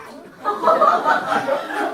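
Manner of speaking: high, loud laugh bursting out; burst of laughter. Real, not acted. Laughing at own outburst story. (자기 이야기에 터지는 높은 웃음)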